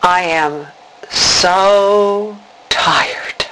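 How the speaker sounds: drawn-out, breathy whisper of exhaustion; tired inner voice, slipping into a whisper; weary inner voice. Retold or imitated. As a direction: let SO sigh out, half whispered. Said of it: "I heard an inner voice say".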